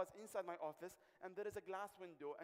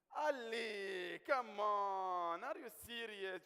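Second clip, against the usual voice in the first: mock upset; 2 semitones higher, 6 dB louder, much slower than usual. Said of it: "She got upset".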